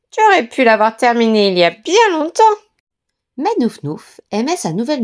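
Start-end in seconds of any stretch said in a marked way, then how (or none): 0.1-2.4 mocking brothers. A narrator's reading. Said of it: "dirent-ils".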